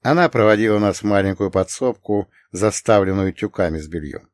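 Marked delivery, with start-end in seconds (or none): none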